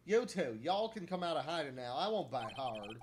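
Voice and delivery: irritated Southern shopkeeper voice; Southern camera lady, annoyed. Acted. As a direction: tell off careless tourists in a drawl.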